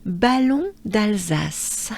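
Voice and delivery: Ballon d'Alsace slow, nasal French on; careful French place name; French pronunciation. Shown as a demonstration. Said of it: "Lots of vowels and funny sounds".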